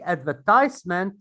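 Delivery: American advertisement, ad-ver-TIZE-ment; advertisement with a long i in the third syllable; American pronunciation. Shown as a demonstration. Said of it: "it's in American".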